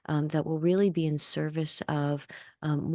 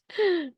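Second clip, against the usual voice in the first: high-pitched delighted giggle; a bright, high little laugh; 4 dB louder than usual. Acted.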